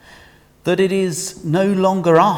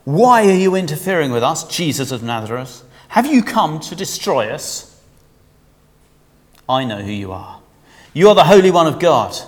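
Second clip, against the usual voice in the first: loud, raised possessed man's cry; demon shouting; 6 dB louder than usual. Acted. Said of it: "cried out, shouting".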